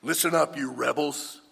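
loud, barking call to the crowd; angry rebuke. Retold or imitated. Moses scolding the grumbling people, loud angry rebuke. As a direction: snap at them like an angry leader. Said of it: "Moses portrays God as being angry".

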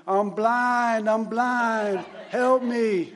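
loud, high, drawn-out mock plea; mock helpless wailing. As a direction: wail it out in hammed-up distress. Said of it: "walking around saying".

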